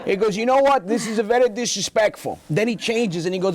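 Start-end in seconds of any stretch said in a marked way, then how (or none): 0.4-2.4 Italian father taking offence; father scolding the absent son, Italian accent; offended Italian dad. Retold or imitated. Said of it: "with that accent".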